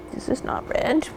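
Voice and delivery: breathy whisper to herself. Real, not acted. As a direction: whisper it under your breath. Muttering while searching.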